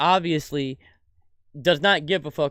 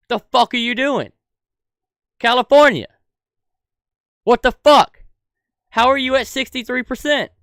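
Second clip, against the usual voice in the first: yelling in real outrage, much higher and louder; furious shouting; 9 semitones higher, 8 dB louder than usual. Real, not acted. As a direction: blast the questions out, truly fed up. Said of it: "I'm fucking pissed".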